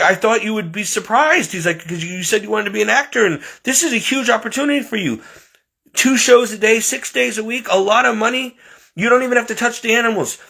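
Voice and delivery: imitating an overexcited boss; excited boss pitch. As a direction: sell him the dream job with rising excitement. SeaWorld boss selling the job.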